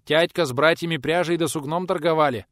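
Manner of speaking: put-on sullen boy. A narrator's reading. Frowning boy answers the man about work, lightly sullen. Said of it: "насупившись, отвечал тот".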